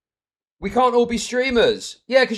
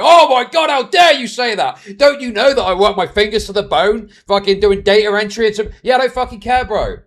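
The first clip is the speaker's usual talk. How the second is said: mock outrage; 7 dB louder than usual. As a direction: imitate an offended critic erupting. Acted outraged reply to mild comment.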